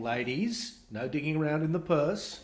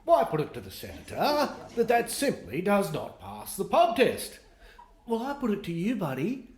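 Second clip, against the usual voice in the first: pompous senator; 5 semitones higher than usual. Retold or imitated. Mocking pompous senator speech.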